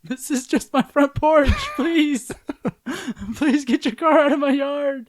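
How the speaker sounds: high, loud, pleading speaker voice; drive thru speaker. Acted. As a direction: beg them off the porch through a speaker.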